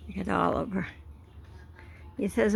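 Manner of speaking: ordinary talk, nothing marked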